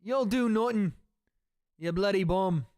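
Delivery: Conor McGregor impression. Streamer quoting Conor's taunt in Irish accent. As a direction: sneer it in a thick Irish brogue. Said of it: "The Muppet one is the best".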